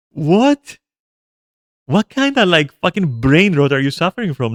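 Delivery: retold disbelief, starting high and loud; acting out appalled confusion; incredulous. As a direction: burst out with a baffled, high-pitched question. Retelling own incredulous reaction.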